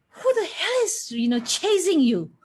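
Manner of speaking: high mock scolding of a late child; imitating a scolding. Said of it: "scolded me".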